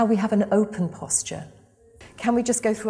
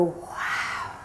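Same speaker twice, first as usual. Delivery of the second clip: breathy wow; 6 dB quieter than usual. Acted. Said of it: "We're gonna go".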